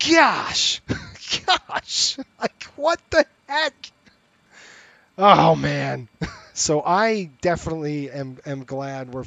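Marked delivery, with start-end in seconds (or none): 0.1-3.7 much higher, strained gosh; exasperated squeal. Real, not acted. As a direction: squeak it out in disbelief. Frustrated parent venting.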